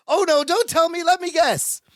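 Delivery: high-pitched mock eager squeal of words; Marianne. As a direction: pitch it way up, playfully stopping someone. Retells Marianne's eager guessing game.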